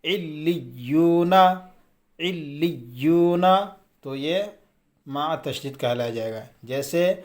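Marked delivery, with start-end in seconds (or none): 0.0-3.4 علیون drawn out slowly, leaning hard on the doubled ل and ی; firm doubled-letter reading. Shown as a demonstration. Teacher showing students how to read tashdeed, firm slow علیون. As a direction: chant علیون slowly, pressing both doubled consonants. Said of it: "اس کو بھی سختی سے پڑھنا ہے".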